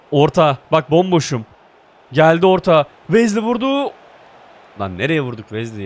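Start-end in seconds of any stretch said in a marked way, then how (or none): 0.1-2.8 loud urgent call; calling for the ball. Real, not acted. Gamer calling teammates for a cross, urgent and loud. 3.1-3.9 high, sudden excited cry; excited call. Real, not acted. Gamer reacting as Wesley shoots, excited.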